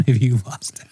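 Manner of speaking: hushed, sneaky deflection; sly laughing whisper. Retold or imitated. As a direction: breathe it out as a guilty half-laughing whisper. Retold dodge of fiance's question.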